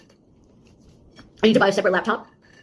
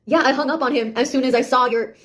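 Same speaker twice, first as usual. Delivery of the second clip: ordinary talk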